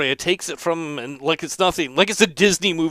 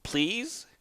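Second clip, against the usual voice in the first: small, high, soft voice; timid high little request; meek plea; 6 semitones higher, 9 dB quieter than usual. Acted. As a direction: plead softly with a questioning lift. Monk meekly accepts offered help.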